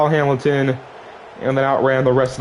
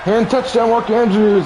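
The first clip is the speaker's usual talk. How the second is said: excited; 9 semitones higher than usual. Real, not acted. Touchdown call.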